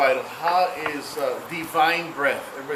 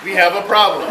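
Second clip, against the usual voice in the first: louder, laughing Houston line; laughing at the breathing demo; mock mission-control; 2 semitones higher, 8 dB louder than usual. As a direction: blurt it out through laughter as a mock astronaut. Quoting the famous line, laughing.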